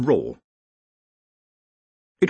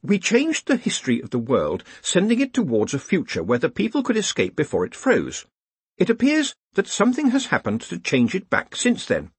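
higher-pitched explaining junior wizard; Ponder Stibbons; 10 semitones higher than usual. Acted. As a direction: explain carefully to a superior, pitched up. Ponder explains to his superior.

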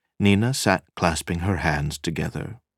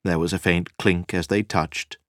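ordinary delivery

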